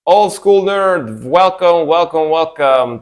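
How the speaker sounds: excited welcome. Real, not acted. Welcoming a raid on stream.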